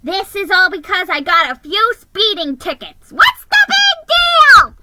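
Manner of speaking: shrill sulky complaint; indignant whine. Acted. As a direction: whine it, feeling wronged.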